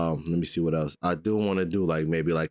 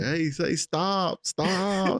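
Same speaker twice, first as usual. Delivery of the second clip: higher, very slow pleading; 11 semitones higher, much slower than usual. Retold or imitated. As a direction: stretch out each stop, begging. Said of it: "He's like".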